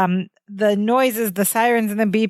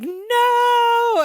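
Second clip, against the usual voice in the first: sharp shout; 14 semitones higher than usual. Retold or imitated. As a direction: shout no to stop him. Larry stops Balky standing.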